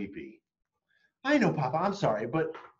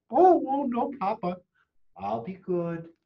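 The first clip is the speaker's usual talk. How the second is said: little chicken voice; 8 semitones higher than usual. A narrator's reading. Child chicken promises.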